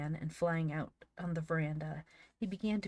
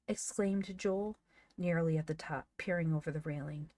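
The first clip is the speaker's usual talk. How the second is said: plain reading voice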